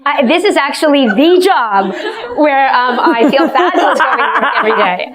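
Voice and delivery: bubbling over with delight at the job; laughing. Real, not acted. Excited about her job, laughing.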